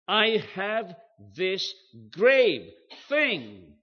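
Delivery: loud, slow, weighty stress on each word of "I have this grave thing"; emphatic quote. Shown as a demonstration. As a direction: punch "grave" high and long, word by word. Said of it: "It is expressed very emphatically".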